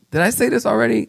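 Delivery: high-pitched, forgetful question; puzzled self-quote. Retold or imitated. Pastor asking his first service, puzzled. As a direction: ask it high, lightly puzzled. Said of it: "I go".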